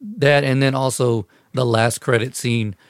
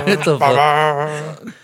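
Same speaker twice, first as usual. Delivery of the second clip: slow laughing disbelief; 6 semitones higher, 5 dB louder, much slower than usual. Real, not acted. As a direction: laugh it out slowly. Laughing at music interruption.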